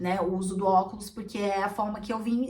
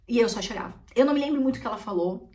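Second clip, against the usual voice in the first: usual speaking voice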